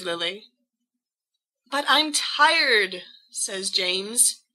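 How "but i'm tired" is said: tired plea. Acted. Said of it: "James pleading".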